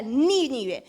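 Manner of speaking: Nínive, opening syllable stretched and hit firmly; accent the "Ní" in Nínive, letting the rest fall; stress on first syllable. Shown as a demonstration. Preacher showing that Nínive is esdrújula, stressed on the first syllable. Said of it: "es una palabra esdrújula".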